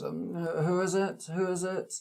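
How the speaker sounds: high, small voice behind a door; small muffled voice. Acted. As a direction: call through the door, small and timid. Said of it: "a small muffled voice".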